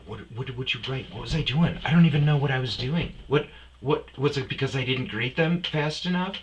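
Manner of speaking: mock panic as the nervous kid; hammed-up fretful employee pleading; anxious coworker. Nervous employee questioned by manager.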